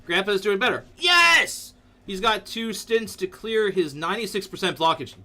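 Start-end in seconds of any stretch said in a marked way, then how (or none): 1.0-1.7 high, loud yes; cheer. Real, not acted. Streamer cheering grandpa's recovery, loud. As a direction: celebrate it.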